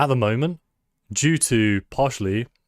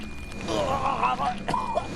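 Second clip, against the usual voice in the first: bursting high laugh; 5 dB quieter than usual. Real, not acted. Laughs at game.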